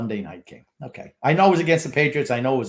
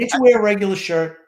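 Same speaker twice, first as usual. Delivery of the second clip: loud teasing; 9 semitones higher, 7 dB louder than usual. Real, not acted. Teasing co-host about his shirts.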